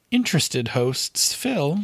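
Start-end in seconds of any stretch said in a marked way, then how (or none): none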